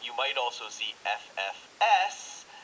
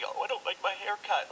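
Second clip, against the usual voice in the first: mock whining; 9 semitones higher than usual. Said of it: "a little silly or dramatic".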